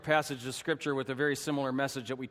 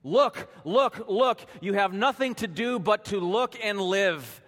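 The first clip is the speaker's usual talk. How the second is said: raised, higher pulpit exhortation; retold preacher's shout; 5 semitones higher, 3 dB louder than usual. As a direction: urge it loudly, imitating an insistent preacher. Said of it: "And then he shouted".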